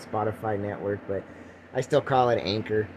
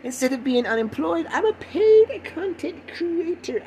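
high-pitched, drawn-out mock parental pride; hammed-up proud parent impression; slower than usual. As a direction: gush in an exaggerated high voice. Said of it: "My mom and dad would be so proud".

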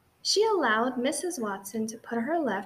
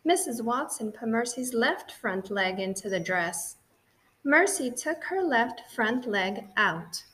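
ordinary delivery, nothing marked

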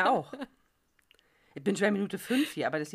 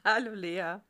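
ordinary talk

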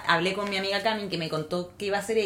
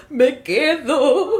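higher, louder, very slow and laughing; mock tearful announcement; 7 semitones higher, 10 dB louder, much slower than usual. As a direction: drag out the decision dramatically. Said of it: "yo llamé a mi jefa como".

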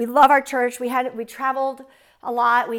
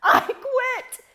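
mock yell, much higher; retold scream; 11 semitones higher, 4 dB louder than usual. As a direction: recall a desperate outburst. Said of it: "I screamed at the top of my lungs".

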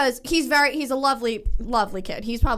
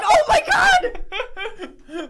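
high, slow, laughing shout; shrieking with laughter at her own blunder; laughing retold oh my god; 6 dB louder, much slower than usual. Friend retelling her gym gaffe, laughing high shout. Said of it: "And I was like".

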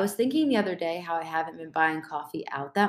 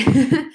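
short rising laugh; 8 semitones higher, 11 dB louder than usual. Real, not acted. Laughs after saying she's tired.

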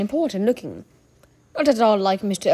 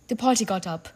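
usual speaking voice

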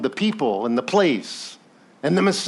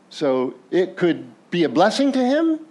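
ordinary delivery

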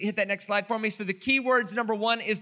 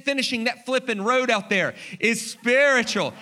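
normal talking voice

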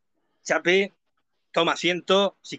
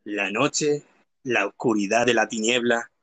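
lower, hushed ghost-story tone; spooky narrator; 6 semitones lower than usual. Pretend. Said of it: "Se vuelve un poco más fantasmal".

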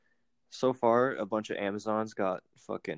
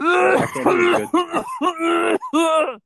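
very high, slow, louder strained squeak; Bubbles impression; 8 dB louder, much slower than usual. Trying Bubbles on a podcast, very high and slow. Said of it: "I can't do a good Bubbles impersonation".

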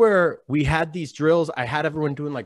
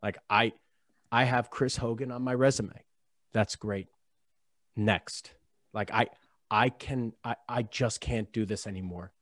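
low, soft, calm voice; 7 semitones lower, 6 dB quieter than usual. Retold or imitated. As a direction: say it quietly with no anger at all. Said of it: "I said very calmly".

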